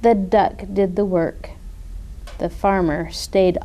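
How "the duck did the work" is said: ordinary delivery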